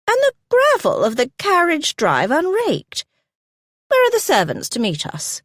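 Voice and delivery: put-on indignant old lady's complaint pitched high; sharp, unheeding. A narrator's reading. Grandmother complains about the unraked drive, sharply indignant. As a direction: keep scolding without pausing for anyone. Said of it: "Grandmamma went on unheeding".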